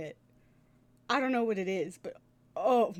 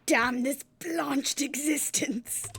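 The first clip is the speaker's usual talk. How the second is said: slow, louder, higher theatrical cry; hammed-up lament; 5 semitones higher, 5 dB louder, slower than usual. Player voicing the detective's melodramatic outburst, hammed-up. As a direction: curse the world like a stage tragedian.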